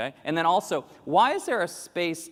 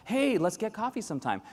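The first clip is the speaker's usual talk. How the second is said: higher breezy offhand invite; casual invite; 7 semitones higher than usual. Retold or imitated. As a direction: offer without meaning it. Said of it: "I'll do that with my European colleagues".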